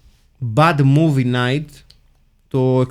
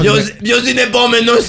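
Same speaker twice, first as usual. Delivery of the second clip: high-pitched hammed-up call for the next in line; jokey calling-out, pitched up; mock queue caller; 7 semitones higher than usual. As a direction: play an exorcist working a waiting room. Acts exorcist calling a queue.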